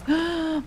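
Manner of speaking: sharp, high intake of breath; startled gasp. Real, not acted. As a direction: gasp at a sudden noise. Notices something in game.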